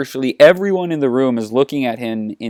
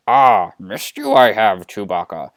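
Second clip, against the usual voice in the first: Yoda; 4 semitones lower than usual. Acted. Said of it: "Yoda says".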